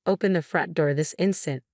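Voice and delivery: ordinary delivery, nothing marked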